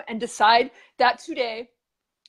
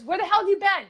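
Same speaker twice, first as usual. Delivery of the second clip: higher and louder, breaking into laughter; mock angry wife; 10 semitones higher, 7 dB louder than usual. Wife scolding husband in joke punchline, mock angry laughing.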